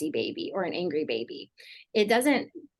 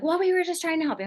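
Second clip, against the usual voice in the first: higher, quick, indignant and defensive; defensive parent; 11 semitones higher, faster than usual. Retold or imitated. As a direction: snap it back like a hurt parent. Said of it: "if they get really defensive".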